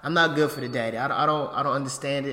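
ordinary delivery

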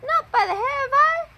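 high squeaky little pigs. A narrator's reading. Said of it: "shouted the pigs together".

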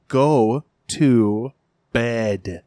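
very slow, word by word; exasperated parental order. Retold or imitated. Parents ordering kid to bed, slowly and exasperated. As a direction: spell it out with strained patience.

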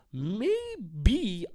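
MAYBE stretched very slowly, pitched high; drawn-out MAYBE. Real, not acted. Conceding he might have thirst trapped, drawn-out high MAYBE. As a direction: draw out both syllables of MAYBE. Emphatic repeat, stretched take.

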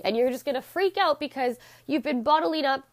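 ordinary talk, nothing marked

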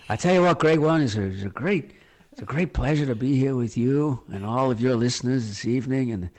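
halting American politician's speech; American statesman thanking listeners, hesitating often; George Bush impression. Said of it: "That was a really good George Bush".

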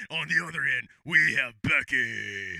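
low, name drawn out slowly; mock ring announcer. Announcer introducing the opponent, mock ring announcer. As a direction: stretch out the fighter's name.

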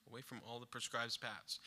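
a hushed second take of the phrase; quiet, reflective repetition; soft echo. Acted.

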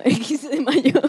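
slow words through laughter; laughing. Real, not acted. Friend recalls fifteenth of May, laughing. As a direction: repeat it slowly while laughing.

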